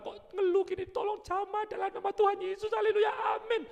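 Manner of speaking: high, loud, climbing to a shouted amen; fervent prayer shout. Pretend. Believer praying fervently, building to a shouted amen.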